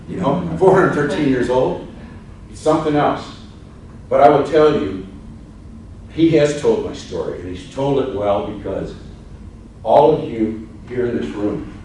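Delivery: ordinary talk, nothing marked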